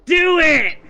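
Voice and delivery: loud, high demanding yell; shouting. Acted. Demon yells demand.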